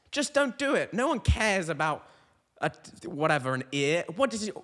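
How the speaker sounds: ordinary talk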